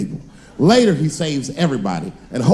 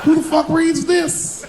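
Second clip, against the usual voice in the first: incredulous; 12 semitones higher, slower than usual. Retold or imitated. Incredulous retort to reporter.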